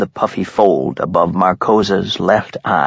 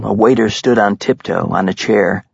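plain reading voice

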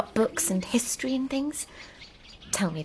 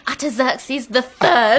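slow mock-triumphant proclamation; mimicking the preacher; 4 semitones higher, 4 dB louder, slower than usual. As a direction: echo the preacher's grand reveal, drawn out. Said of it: "you'll be exactly like that someday".

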